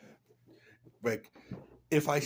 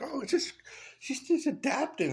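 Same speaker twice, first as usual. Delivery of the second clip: much higher mock voice; mocking defenders. Mocking her defenders in a high, silly voice. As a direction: squeak it out like a naive fan. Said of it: "and they're like".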